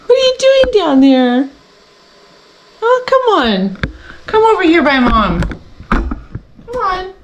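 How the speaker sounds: high sing-song pet voice. Real, not acted. Mom coaxing her pet in a high voice. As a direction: coo up high at the dog.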